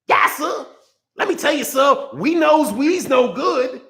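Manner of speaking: high, slow, put-on groveling voice; mock subservient servant. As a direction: ham up a bowing yes-sir servant.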